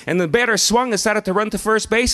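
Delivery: plain reading voice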